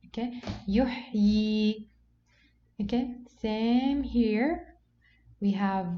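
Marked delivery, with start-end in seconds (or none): 0.7-1.9 yuhyi drawn out, ending on a long ii; double-ya demonstration. Shown as a demonstration. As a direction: teach yuhyi slowly with two yas at the end. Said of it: "As if we have two yas".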